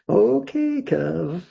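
high, drawn-out okay, dropping on the name; mock grudging consent. Annoyed singer giving in to her guitarist, mock grudging. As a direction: give in with exaggerated reluctance. Retold grudging reply after stink eye.

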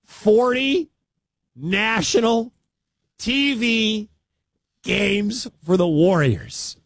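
high, slow, disbelieving repeat; incredulous. Real, not acted. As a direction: stretch it out in disbelief.

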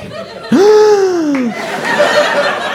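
long, high gasp of realization; horrified gasp. Retold or imitated. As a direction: suck in air in mock panic. Sudden realization of who calls.

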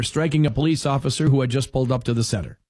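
ordinary delivery

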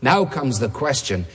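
ordinary talk, nothing marked

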